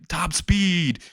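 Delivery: high, urgent sprinting call; acted battle cry. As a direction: call it out as you speed off. Acted cry while running faster.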